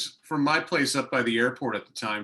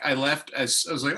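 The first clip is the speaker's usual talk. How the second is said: ordinary talk, nothing marked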